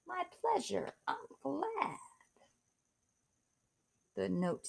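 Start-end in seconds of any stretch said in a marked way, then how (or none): 0.1-2.0 high, soft pleased reply; a lightly voiced rock star, higher and softer. Rock star replying to Beauty, higher soft voice.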